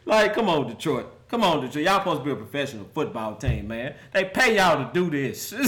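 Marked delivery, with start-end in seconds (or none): none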